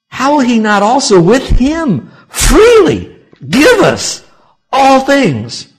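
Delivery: full-force preaching. Acted. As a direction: proclaim it to the whole congregation.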